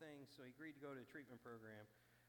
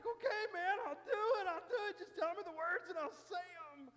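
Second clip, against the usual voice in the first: much higher and louder, crying as he gives in; tearful surrender; 14 dB louder than usual. Retold or imitated. Shaken drunk giving in to the yelling buddy, crying. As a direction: sob out your surrender. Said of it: "emotional wreck".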